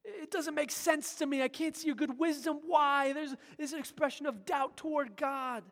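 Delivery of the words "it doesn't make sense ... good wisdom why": anguished prayer. Acted. Joshua crying out to God in doubt, pleading. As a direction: cry out to God in bewildered grief. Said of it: "he says".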